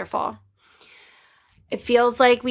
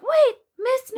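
high-pitched imitation of a young pupil; little kid. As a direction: call out like a small child, much higher. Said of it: "one of my little ones is like".

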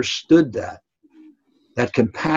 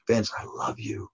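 much softer, hushed and tender; tender whisper; 10 dB quieter than usual. Retold or imitated. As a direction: whisper it close while holding him. Coach consoling crying player.

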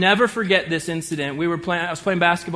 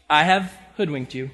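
normal talking voice

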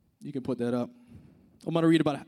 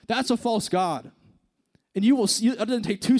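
rising preacher's fervor, pitched higher; impassioned preaching; 5 semitones higher than usual. Acted. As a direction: drive the warning home to the youth. Preaching passage on false gods.

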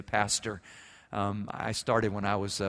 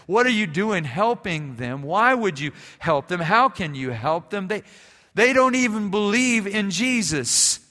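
raised, higher outraged questioning; indignant student; 7 semitones higher, 6 dB louder than usual. Retold or imitated. Seminary student objecting to helping the refugees, indignant and loud. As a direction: protest hotly, voice climbing. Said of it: "He was indignant".

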